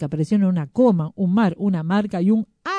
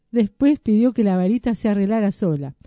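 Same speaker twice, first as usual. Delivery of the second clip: usual speaking voice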